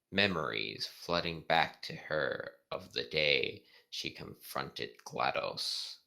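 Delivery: slow, soft read-aloud narration; story narration. A narrator's reading. Reading the sad fanfic scene to viewers, slow soft narration.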